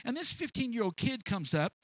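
ordinary delivery

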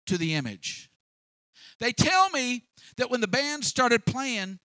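loud, high royal interrogation; confronting king. Acted. King confronting the three who stayed standing, loud and indignant. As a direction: call them to account with mounting indignation.

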